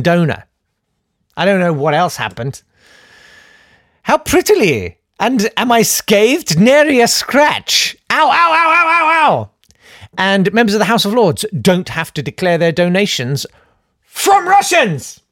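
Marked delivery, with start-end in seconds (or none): 4.0-9.5 high-pitched dainty boasting into comic yelps; smug boast, mock pain. Acting smug Tory getting hurt. 14.0-15.2 slow, high-pitched incredulous cry; mock outrage.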